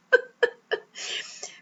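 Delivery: high, breathy half-sob of a laugh; rueful cry-laugh. Real, not acted. Finding a dent in the gifted new car, high rueful cry-laugh. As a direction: laugh so you don't cry.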